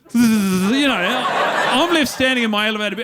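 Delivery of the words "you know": ordinary talk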